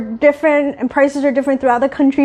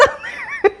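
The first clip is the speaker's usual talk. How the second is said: high laugh; 7 dB quieter than usual. Real, not acted. Laughs at own disclaimer.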